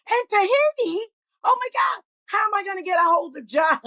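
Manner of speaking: high-pitched retold panic; freaking out. Woman learning her contact is away in Tahiti, mock freak-out. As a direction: pitch up in disbelief, then wail the question. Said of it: "and I was freaking out".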